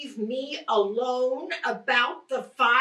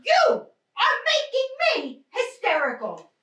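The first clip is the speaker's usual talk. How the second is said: hysterical outburst; 9 semitones higher than usual. Acted. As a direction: burst out high then snap back. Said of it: "You're hysterical".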